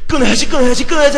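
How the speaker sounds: loud, high shouted vow; hammed-up shouted self-promise; mock vow. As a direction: shout the resolution in mockery. Mimicking the repeated vow.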